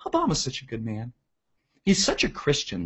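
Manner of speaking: soft, fawning imitation of admirers; hushed put-on reverence; mock adoring. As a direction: gush quietly in mock admiration. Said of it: "liberals just love this shit. They're like".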